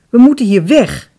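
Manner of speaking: loud, high-pitched demand; forceful exclamation, pushing to flee; urgent cry. A narrator's reading. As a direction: insist on WEG, loudly. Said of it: "riep hij".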